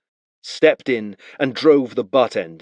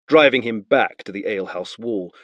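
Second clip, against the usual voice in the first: ordinary delivery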